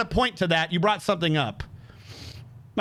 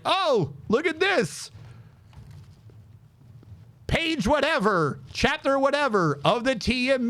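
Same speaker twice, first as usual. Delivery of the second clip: smug; 6 semitones higher than usual. Retold or imitated. Smugly showing the manual.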